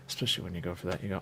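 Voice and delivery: normal talking voice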